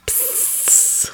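drawn-out unvoiced hiss; whispered. A narrator's reading. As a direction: hiss softly for a friend's attention. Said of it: "he whispered".

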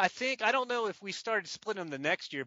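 ordinary talk

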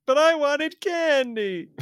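put-on high, drawn-out childish whine; mock wail of a disappointed child; whining kid. Voicing disappointed child self given money.

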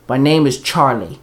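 usual speaking voice

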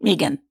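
Megan said low as MEE-gan; MEE-gan pronunciation. Shown as a demonstration. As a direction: settle on Megan with a long "ee". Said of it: "is it Megan or Megan?".

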